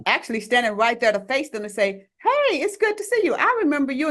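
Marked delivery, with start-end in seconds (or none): 2.2-4.1 much higher, cheerful hello; friendly greeting. Pretend. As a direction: greet an old victim gladly.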